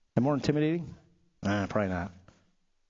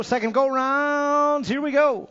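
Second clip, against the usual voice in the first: slow, stretched, higher race call; drawn-out announcer call; 10 semitones higher, 4 dB louder, slower than usual. Acted.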